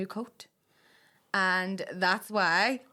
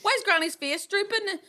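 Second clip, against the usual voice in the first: mock child; 10 semitones higher than usual. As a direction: play an innocent kid asking. Mock child asking about granny.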